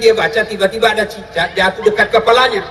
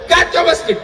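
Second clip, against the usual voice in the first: preacher's raised second take of kacau masjid; emphatic shout; 6 semitones higher than usual. Real, not acted. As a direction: push the repeat up into a cry.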